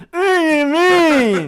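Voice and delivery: very high slow wailing ghost; crying ghost voice. Shown as a demonstration. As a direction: wail it like a mangled ghost. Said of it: "maybe do something like".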